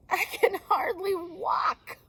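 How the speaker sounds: high, slow, whining little bird; pitiful whine. Acted. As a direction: make it sound so pitiful. Bird's pitiful high complaint.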